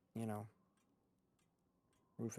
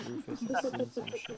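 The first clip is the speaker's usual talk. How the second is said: a touch higher, mock-grizzled dog voice; lightly voiced gruff Rufus; 13 semitones higher, 3 dB louder than usual. GM voicing the dog for the players, mock-grizzled. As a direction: give the dog a hint of a war-weary voice. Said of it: "repeated in a new voice".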